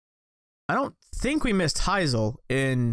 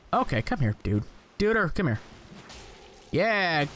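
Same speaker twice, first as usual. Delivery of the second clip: ordinary talk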